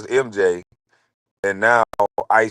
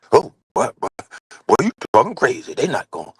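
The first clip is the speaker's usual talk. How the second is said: scared mock voice; 2 semitones higher than usual. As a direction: imitate a frightened friend backing down.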